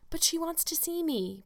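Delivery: put-on high, begging girl's voice; pleading. A narrator's reading. Young Anne pleading with Marilla, high and begging. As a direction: plead for permission like a child. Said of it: "pleaded Anne".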